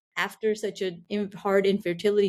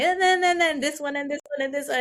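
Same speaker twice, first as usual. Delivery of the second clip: higher, piling up complaints in a playful whine; mock complaining; 7 semitones higher than usual. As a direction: list every grievance, mocking your past self, higher. Retelling herself complaining in therapy.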